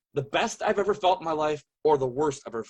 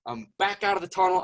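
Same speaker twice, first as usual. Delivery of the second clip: normal talking voice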